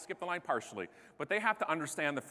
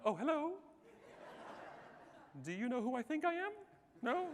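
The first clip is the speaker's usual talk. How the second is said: small, high, soft voice; meek backing down; 7 semitones higher, 10 dB quieter than usual. Retold or imitated. Timid Canadian facing the attendant who turned around, meek. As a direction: shrink away timidly, softly.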